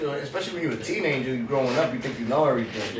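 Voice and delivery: normal talking voice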